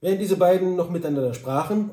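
usual speaking voice

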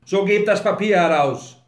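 loud, high-pitched insistence; angry demand. A narrator's reading. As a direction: order them to hand it over. Grinley demands the paper again.

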